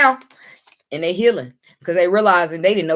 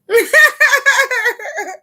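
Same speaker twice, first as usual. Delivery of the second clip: shrieking high-pitched laugh; 4 dB louder than usual. Real, not acted. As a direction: burst into a squealing laugh. Tarot reader bursting with laughter.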